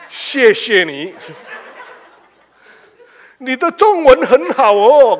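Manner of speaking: slow, loud Mandarin in a foreigner's careful manner; slowly and proudly show off learned Mandarin; foreigner speaking Chinese. Retold or imitated. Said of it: "Dia ngomong Chinese".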